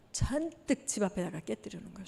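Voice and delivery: ordinary talk, nothing marked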